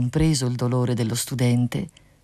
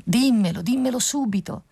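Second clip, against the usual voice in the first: urgent cry; 9 semitones higher than usual. A narrator's reading. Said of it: "gridò più forte l'usignolo".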